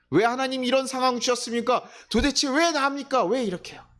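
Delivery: high, pleading cry of why; anguished complaint. Acted. Believer crying out to God in anguish, high and pleading. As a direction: wail it up at heaven, asking why me.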